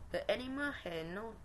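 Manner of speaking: ordinary delivery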